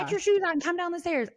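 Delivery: imitating a mom's high yell to the kids; mock mom yelling. Mom yelling at the kids to get ready, mock high yell. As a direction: playfully yell the chore orders. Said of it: "Ha, ha, ha, mom's yelling".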